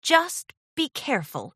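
careful friendly warning; gentle urging. A narrator's reading. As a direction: urge caution without raising the volume. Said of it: "Twilight urged".

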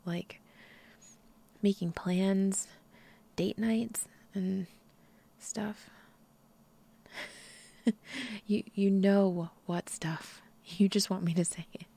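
ordinary delivery

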